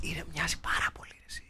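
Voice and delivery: very soft, secretive whisper; hushed whisper. Pretend. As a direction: whisper it confidentially to your co-host. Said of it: "μπορούμε να μιλάμε πιο σιγά". (πολύ σιγανός ψίθυρος)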